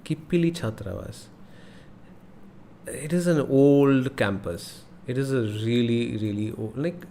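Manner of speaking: usual speaking voice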